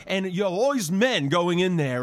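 normal talking voice

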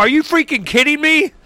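high, disbelieving retort; incredulous. Retold or imitated. Scoffing at a newsman's fake news complaint, incredulous. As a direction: act like you can't believe your ears. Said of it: "I'm like".

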